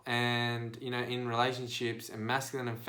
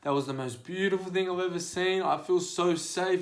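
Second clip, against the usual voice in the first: tearful; 5 semitones higher than usual. Retold or imitated. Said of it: "Women were in tears saying".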